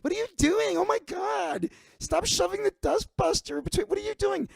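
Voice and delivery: very high, shrill panicked woman's squeal; shrieking Sarah. Retold or imitated. Sarah shrieks at Big Mike chasing her. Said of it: "and he said".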